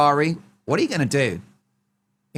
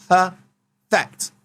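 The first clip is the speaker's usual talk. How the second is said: mock-grand perfect; 4 semitones higher, 4 dB louder than usual. Final punch word.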